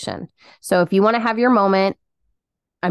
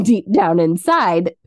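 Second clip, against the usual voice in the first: ordinary talk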